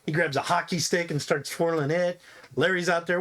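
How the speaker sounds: ordinary talk, nothing marked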